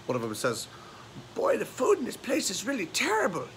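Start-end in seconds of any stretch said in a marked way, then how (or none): 1.4-3.5 put-on high-pitched old lady complaining; elderly woman. Elderly resort guest griping about the food, high old-lady voice. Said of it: "two elderly women are at".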